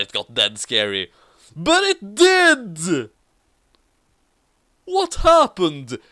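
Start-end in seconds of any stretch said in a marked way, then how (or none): 1.6-3.1 dramatic exclaim. Acted. Dramatic narration. 4.8-6.0 high character voice. Acted. Character question.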